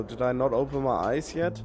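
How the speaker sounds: ordinary delivery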